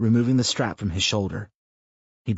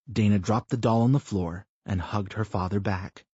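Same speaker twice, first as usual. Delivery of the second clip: plain reading voice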